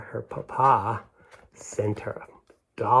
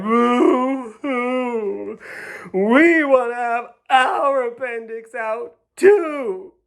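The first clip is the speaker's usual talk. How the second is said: much higher, louder, slow boo-hoo wail; mock crying girls; 13 semitones higher, 8 dB louder, much slower than usual. Girls crying to get their appendix out too, high mock wail. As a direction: wail like jealous little girls. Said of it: "all the little girls cried".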